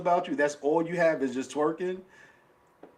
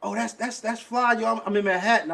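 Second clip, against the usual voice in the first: put-on higher, lively voice of a girl on the phone; mock excited girl; 7 semitones higher than usual. Retelling the girl who claims Manhattan, higher mock excitement. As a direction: pitch it up like an eager girl. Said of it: "She was like".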